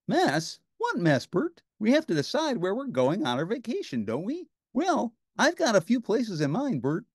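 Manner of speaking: high, bouncy puppet voice; Ernie voice. A narrator's reading. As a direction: play a cheerful high puppet.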